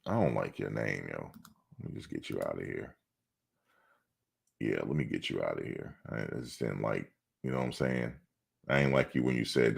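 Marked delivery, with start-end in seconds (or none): 0.0-8.1 much softer, under his breath; muttering. Real, not acted. To a chatter he is kicking out, muttered softly. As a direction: mutter it half to yourself.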